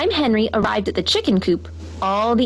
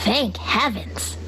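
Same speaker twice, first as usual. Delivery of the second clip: very slow, softer relief; relieved; 4 dB quieter, much slower than usual. A narrator's reading. Henry finds Abigail, very slow and soft. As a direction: breathe out in relief.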